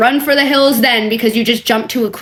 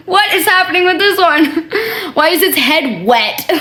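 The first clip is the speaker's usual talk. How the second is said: high laughing disbelief; 4 semitones higher than usual. Real, not acted. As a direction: laugh through the question. Laughing at the emoji.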